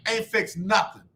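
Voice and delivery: usual speaking voice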